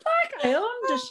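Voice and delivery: high, laughing smug swagger; smug strut. Retold or imitated. Mother strutting into the airport, laughing smug pride. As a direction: show off the new business-class strut. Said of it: "I got a new walk".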